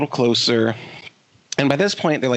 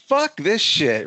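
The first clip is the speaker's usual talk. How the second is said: slow, drawn-out, higher-pitched mock outburst; retold loud outburst; 6 semitones higher, much slower than usual. Line-waiter protesting in front of everybody, mock outburst. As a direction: stretch it out as a retold public outburst. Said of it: "really loud in front of everybody".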